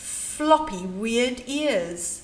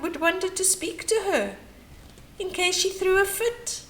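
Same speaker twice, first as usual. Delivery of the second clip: usual speaking voice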